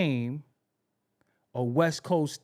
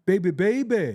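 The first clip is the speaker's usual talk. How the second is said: [name] ad-lib; 2 semitones higher, 6 dB louder than usual. Retold or imitated. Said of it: "that was all [name]".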